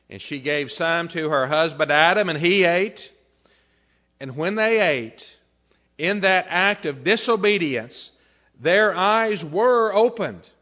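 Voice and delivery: loud, rising preaching voice; forceful preaching. Real, not acted. Preacher tells congregation of Adam and Eve's disobedience, forceful. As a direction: build in pitch and force to the last phrase.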